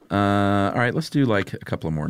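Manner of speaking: ordinary talk, nothing marked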